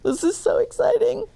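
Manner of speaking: fast, high, thrilled aside; excited. A narrator's reading. Narrator gushes at the climax. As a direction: rush it out with delight.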